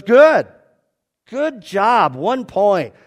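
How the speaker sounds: loud, high mock congratulation; booming praise. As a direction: announce it like a cheering examiner. [name] acted handing out praise.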